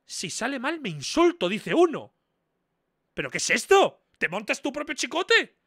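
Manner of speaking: loud, high-pitched disbelieving rant; incredulous outburst. Real, not acted. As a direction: shout it in baffled disbelief. Reacts in disbelief to viewer's message.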